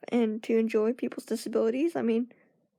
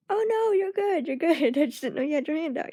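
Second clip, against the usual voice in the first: laughing reassurance; 5 semitones higher than usual. Retold or imitated.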